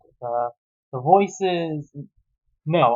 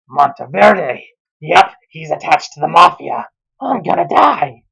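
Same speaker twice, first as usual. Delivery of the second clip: louder, gasping alarm, hammed-up; mock panic; 7 dB louder than usual. Narrator realizing the dad is mafia, hammed-up panic. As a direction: realize the danger with a gasp.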